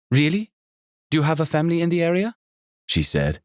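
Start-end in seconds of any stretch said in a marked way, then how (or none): 0.1-2.3 high, lively hostess voice with a faint Jamaican lilt; a welcoming, bubbly landlady; energetic innkeeper. Acted. Sonia curious.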